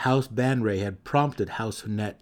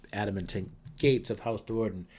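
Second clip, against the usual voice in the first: usual speaking voice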